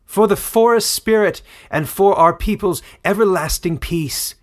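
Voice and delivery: rallying shout as the sword rises. A narrator's reading. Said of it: "she shouted".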